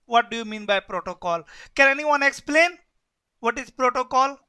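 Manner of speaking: ordinary delivery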